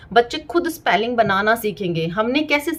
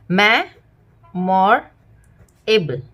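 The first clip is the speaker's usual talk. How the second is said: Memorable drawn out very slowly, part by part; 2 semitones lower, much slower than usual. Shown as a demonstration. Teacher showing parents how kids split a word, very slow. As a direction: sound out memorable in three slow parts. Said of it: "तो बच्चे इसको".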